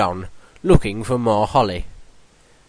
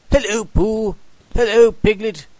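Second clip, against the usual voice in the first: put-on bright, much higher boyish voice; a young boy's cheerful hello, much higher; cheerful Christopher Robin; 10 semitones higher, 3 dB louder than usual. A narrator's reading. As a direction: greet your friends gladly, pitched up. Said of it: "said Christopher Robin cheerfully".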